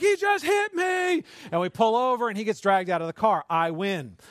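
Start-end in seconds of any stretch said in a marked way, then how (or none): 0.0-1.2 high, loud, drawn-out mock wail; tattling kid yell. Little brother tells on brother to dad, mock wail.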